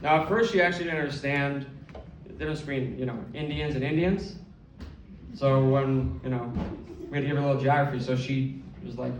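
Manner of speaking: usual speaking voice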